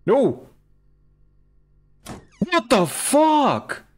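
high, loud, stretched-out shock; startled shout. Real, not acted. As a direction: yell in real fright. Susto por una anomalía. (grito de susto real)

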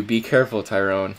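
ordinary talk, nothing marked

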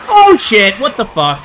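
high shrieking fright; startled scream. Real, not acted. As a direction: jump scare shriek. Monster appears suddenly.

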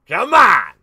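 loud burst of impatience; impatient shout. Real, not acted. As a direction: shout it at someone who won't answer. Winner has not answered the calls.

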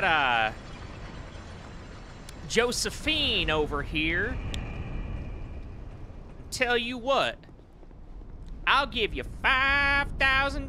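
hammed-up, high-pitched southern accent. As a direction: play a southern good ol' boy buttering up a young feller. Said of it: "I did a southern accent".

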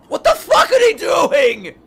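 high, slow, loud shout; furious shout. Real, not acted. Viewer shouting as the farewell scene unfolds. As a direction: yell it at the screen. Fan yells at the screen.

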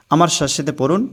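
ordinary talk, nothing marked

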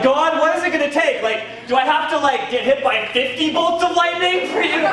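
high, laughing complaint to God; preacher pleading with God, high-pitched and laughing; mock exasperated prayer. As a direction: whine up at heaven in comic frustration.

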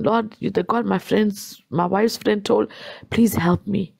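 normal talking voice

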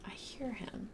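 hushed whisper while hiding. Real, not acted. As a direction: breathe it so he can't hear. Hiding from the beast.

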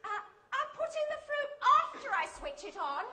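high, quick British-lady voice; polite British query. Retold or imitated. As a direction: check the instruction politely and briskly. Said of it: "She said".

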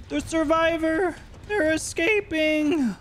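high, slow hammed-up alarm; mock alarm. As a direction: cry it out like a panicked guard. Mock alarm at escaping survivors.

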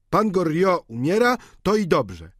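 mock count. Servant quotes the count about the dying father, mock. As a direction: quote the count's cold words. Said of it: "sam pan hrabia wyszedł do mnie i tak powiedział".

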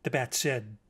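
plain reading voice

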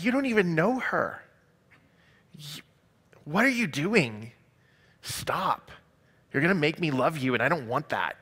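swooping pitch on a bewildered objection; acted exasperated protest. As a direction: protest as if begging him to stop. Acted inner protest at his kindness.

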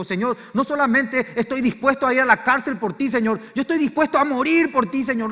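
fervent, loud, high vow to the Lord; Peter's vow. Retold or imitated. Preacher voicing Peter swearing loyalty, loud and fervent. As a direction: proclaim it like a man ready to die. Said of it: "cuando dijo".

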